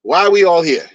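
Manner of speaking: usual speaking voice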